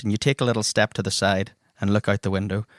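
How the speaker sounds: normal talking voice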